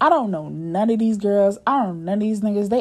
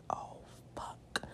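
barely audible whispered curse; hushed dread; 22 dB quieter than usual. Retold or imitated. Woman mutters to herself on spotting him, hushed dread. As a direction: whisper it under your breath. Dread on seeing the other man.

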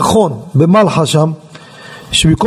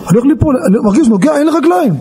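high, rushed retelling of panic; retold panic; 7 semitones higher, much faster than usual. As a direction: relive the panic in a raised pitch. Reliving losing his legs. (שחזור של פאניקה)

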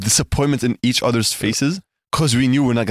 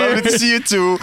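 mock polite; 8 semitones higher than usual.

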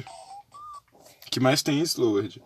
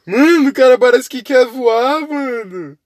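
excited; 9 semitones higher, 10 dB louder, slower than usual. Real, not acted. Excited about jumping character.

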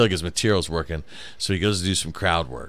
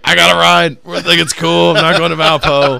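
mock excuse; 8 semitones higher, 9 dB louder than usual. Mock quote of comic's excuse.

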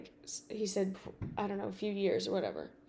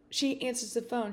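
normal talking voice

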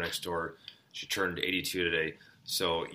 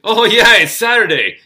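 loud, high mock realization; playful teasing; 14 semitones higher, 18 dB louder than usual. Neighbor toying with birthday woman about the day, playful teasing. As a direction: act as if it just dawned on you, teasing. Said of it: "you can tell his voice, you know he's playing with her".

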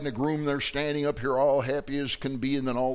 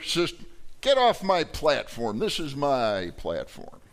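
a puffed-up boss barking orders; bossy domineering spouse; 4 semitones higher than usual. Acted. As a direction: order them off your platform. Preacher acts the bossy partner.

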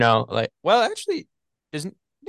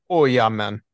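loud mock Jamaican drawing of the words; accidental Jamaican accent; 3 semitones lower, 5 dB louder, slower than usual. Friend attempting a Russian accent, slipping into Jamaican. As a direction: try an accent and land in Jamaican.

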